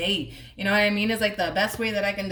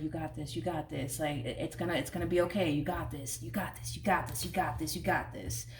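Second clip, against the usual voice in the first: hushed, softer repeated reassurance to oneself; quiet self talk; 3 semitones lower, 7 dB quieter than usual. Retold or imitated. Person telling themselves to stay calm, hushed. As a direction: murmur it like calming your own nerves. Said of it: "they're telling themselves".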